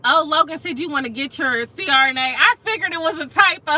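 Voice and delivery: loud, amused disbelief; amused outburst. Real, not acted. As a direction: call it out, cracking up. Reacting to funny chat comment.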